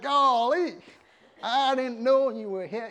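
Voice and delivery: high, loud, drawn-out Southern American amazement; hammed-up Southern American excitement; excited Southern American. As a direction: play a thrilled Adam with a Southern American accent. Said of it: "This guy was excited".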